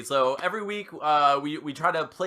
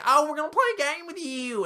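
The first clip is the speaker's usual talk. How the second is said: much higher, sing-song fake-friendly voice; mock sweet host; 12 semitones higher than usual. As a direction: lure the guest in with a sugary higher tone. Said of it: "We're gonna be like".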